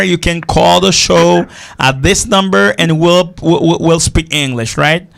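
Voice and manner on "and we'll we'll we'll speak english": ordinary talk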